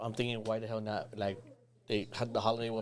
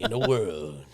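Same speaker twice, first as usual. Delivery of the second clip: movie trailer voice; 5 semitones lower, 6 dB louder, slower than usual. Pretend. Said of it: "you sounded like a movie trailer guy".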